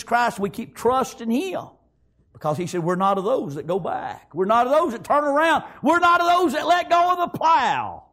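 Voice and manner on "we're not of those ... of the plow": high, rallying pulpit delivery; preaching. Real, not acted. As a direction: drive the refrain upward like a revival preacher. Preaches at full force.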